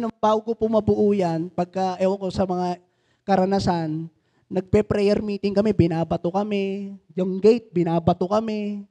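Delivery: ordinary talk, nothing marked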